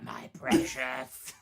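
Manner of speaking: slow, hushed Gollum hiss; Gollum impression. Mimicking Gollum gloating over the ring, slow. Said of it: "Gollum when he's got the ring".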